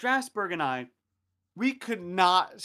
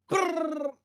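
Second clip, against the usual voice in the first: high trilled burst of gunfire; imitated machine-gun fire; 11 semitones higher than usual. Soldier firing after random words, trilled gunfire. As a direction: rattle your lips like an automatic weapon.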